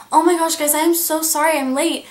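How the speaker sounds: high, loud, breathless sorry; imitating herself bursting in late and gushing apologies; retold flustered apology. Late worker apologizing to coworkers, high and loud.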